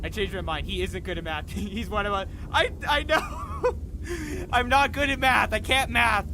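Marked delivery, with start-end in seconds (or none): 2.5-6.3 loud laughter through the words; laughing. Real, not acted. Streamer joking with chat about math, laughing. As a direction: giggle out the confession.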